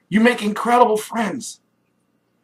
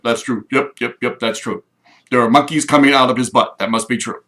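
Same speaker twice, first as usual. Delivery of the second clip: lower, dull yes-man drone; mock agreeing crowd; 8 semitones lower than usual. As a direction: nod along like a sheep. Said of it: "and everybody's like".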